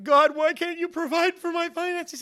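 very high, loud whining plea; hammed-up frustrated protest; mock complaint. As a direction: wail it at God in an exaggerated high voice. Mimics frustrated complaint to God.

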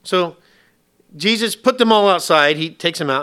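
ordinary talk

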